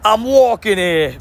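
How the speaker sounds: drawn-out, hammed-up New York accent; attempted New York accent. Shown as a demonstration. Streamer quoting a classic line, mock New York accent. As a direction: call it out slowly like an amateur New Yorker. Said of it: "That, that one too".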